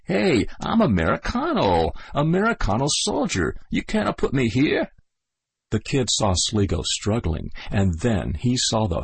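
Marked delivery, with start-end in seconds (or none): 0.0-4.9 higher, a mild mock shriek of protest. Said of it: "He heard him shrieking".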